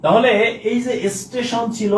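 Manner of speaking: ordinary talk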